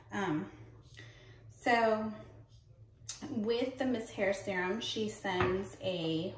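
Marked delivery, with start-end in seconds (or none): none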